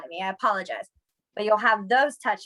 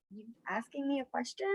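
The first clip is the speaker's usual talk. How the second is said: softer, knowing question; pointed look; 13 dB quieter than usual. Retold or imitated. Teacher questioning a kid's flat face, pointed. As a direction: quiz them with a look. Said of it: "I literally look at them like".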